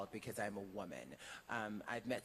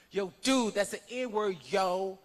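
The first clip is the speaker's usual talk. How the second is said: much higher and louder, a mocking yell; mock shouted objection; 11 semitones higher, 14 dB louder, slower than usual. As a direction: bark it out like a guy correcting his buddy on the street.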